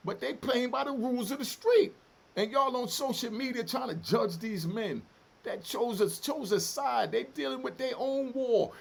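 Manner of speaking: loud, high-pitched, forceful warning; impassioned rant. Real, not acted. Ex-prisoner warning social media judges about the street, passionate and emotional. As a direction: drive it home with heated force. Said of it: "I get emotional and passionate".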